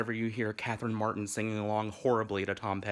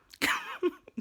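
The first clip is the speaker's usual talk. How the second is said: very high squeal of laughter; sudden high giggle; laughing; 4 dB louder than usual. Real, not acted. Laughs at the accent joke.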